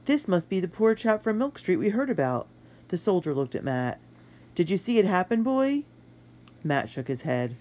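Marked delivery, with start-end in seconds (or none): 0.0-2.5 soldier voice. Acted. 4.5-5.8 soldier voice. Acted. Soldier questions boy.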